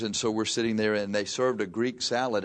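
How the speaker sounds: ordinary delivery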